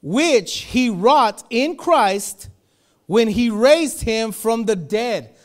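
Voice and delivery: loud, very slow, weighty reading of the Word; slow scripture reading. Acted. Preacher reading scripture to the church, slow and loud. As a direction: lay each word of scripture down slowly. Reads scripture aloud slowly.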